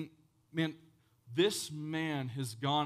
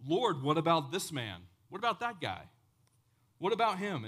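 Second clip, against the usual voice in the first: higher-pitched mock complaint; indignant protest; 4 semitones higher, 5 dB louder than usual. Peter complains to Jesus about the disciple, indignant. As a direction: Peter pointing at the other man, put out.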